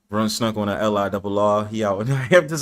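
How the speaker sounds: ordinary talk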